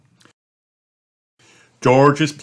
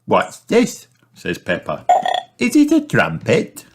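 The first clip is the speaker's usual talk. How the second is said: put-on very high, louder little girl; curious Peppa; 4 semitones higher, 3 dB louder than usual. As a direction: Peppa finds the tail. Peppa finds something.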